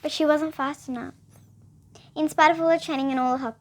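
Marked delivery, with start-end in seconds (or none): none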